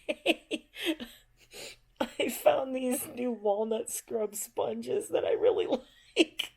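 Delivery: laughing through a high, slow put-on voice; hammed-up sponge fan cracking up; mock cheery shopper. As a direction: poke fun at a gushing shopper, giggling. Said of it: "now I'm like".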